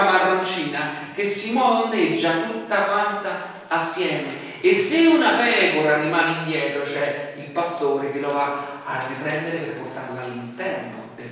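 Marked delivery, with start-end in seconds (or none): none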